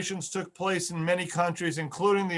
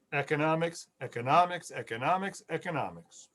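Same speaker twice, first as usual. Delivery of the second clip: each "economics" softer than the last, fading out; fading repetition; 3 semitones lower, 5 dB quieter than usual. Shown as a demonstration. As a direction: hammer "economics" then let it die away. Lecturer repeats key word for effect.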